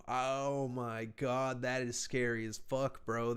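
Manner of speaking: usual speaking voice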